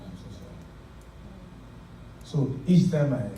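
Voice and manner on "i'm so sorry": whispered apology. Retold or imitated. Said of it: "I said".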